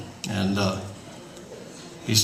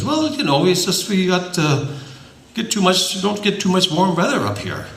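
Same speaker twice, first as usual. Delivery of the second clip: lightly voiced folksy Minnesotan, higher; a hint of a cheerful Scandinavian farmer, higher; mild put-on Sven voice, raised pitch; Sven's voice; 7 semitones higher than usual. Sven answers the devil.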